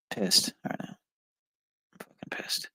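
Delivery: hushed, furious venting; barely voiced rage at a test; seething whisper. Real, not acted. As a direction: whisper the anger through the teeth. Angry at a test, alone.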